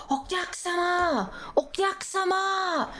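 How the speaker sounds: high, thin mock call of okyakusama; imitating a man screaming. Imitating a collector calling at her door, high mock calling. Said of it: "Like, screaming".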